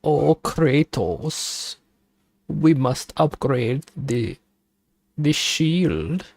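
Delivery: mock German accent, slow on the name; a lightly hammed German advisor urging Kratos. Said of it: "Why are you speaking like this?".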